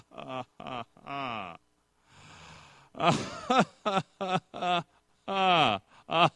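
slow, mocking villain laugh built from separate "ah"s; malicious laugh. Shown as a demonstration. Preacher showing congregation how someone laughs at you, malicious laugh. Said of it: "What is a malicious humor? ... they go".

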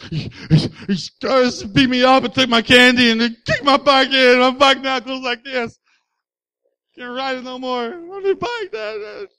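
put-on high, loud upset kid voice; upset child's voice. Boy telling dad about the bullies, high and upset. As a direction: blurt it out like a distraught little boy.

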